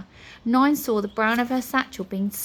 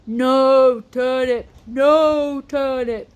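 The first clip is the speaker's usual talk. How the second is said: loud, slow, strange pleading; crying creature voice; 4 semitones higher, 8 dB louder, much slower than usual. A narrator's reading. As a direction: wail it like a small creature. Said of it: "Eric cried".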